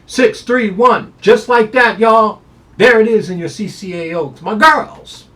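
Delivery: high, loud hyped-up call; excited hype. Real, not acted. Handicapper pumping up his top pick, loud and high. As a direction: shout out the top pick like a showman.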